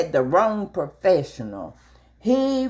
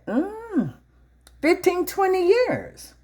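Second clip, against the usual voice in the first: hum, then brag about the years, pitched way up; proudly boasting; 13 semitones higher than usual. Retold or imitated. Telling the officer her clean record, proud and high. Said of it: "I said".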